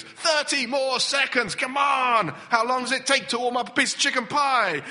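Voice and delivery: loud, high, exasperated yelling at the microwave; hammed-up impatience, shouting; impatient shout.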